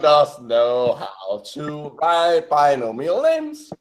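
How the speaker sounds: very slow, stretched-out words; drawn-out teasing. Real, not acted. Podcaster remarking on correctly written species names, slowly drawn out. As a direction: draw each word out like a singsong tease.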